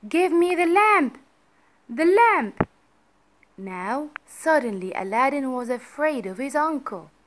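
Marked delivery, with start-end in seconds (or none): 0.1-2.7 high, loud furious order; angry demand. A narrator's reading. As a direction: demand it in a raised, angry shout. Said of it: "said the magician angrily".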